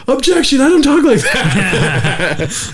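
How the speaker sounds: high, drawn-out mock protest; girlfriend voice. Girlfriend as plaintiff objecting in court, girlfriend voice. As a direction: imitate an indignant objection high up, laughter creeping in. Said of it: "talking like that".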